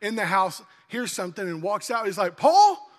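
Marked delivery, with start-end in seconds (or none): 2.4-2.9 loud call across the yard. Retold or imitated. Dad calling for his son, loud call. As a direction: call his name from the house.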